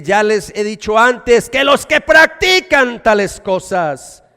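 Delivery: slow, loud preaching of the warning; forceful scripture. Acted. Preacher warning the church, slow and loud. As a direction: proclaim each word like a verdict.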